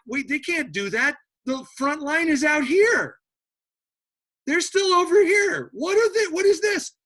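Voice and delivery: high, loud, rising panic; baffled German shout. Acted. Germans shouting in confusion at troops behind their lines, high and loud. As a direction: yell in disbelief that the enemy got behind you. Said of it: "And the Germans are like".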